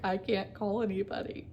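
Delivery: tearful, choked plea; retold crying into the phone. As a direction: say it through tears. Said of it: "just crying".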